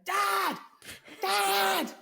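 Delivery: calling out loud and high; a kid's loud call from the back door; retold shout. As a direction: yell for dad across the house. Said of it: "I can just hear him going".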